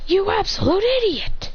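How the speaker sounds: high-pitched acted outrage; mock exasperated scolding.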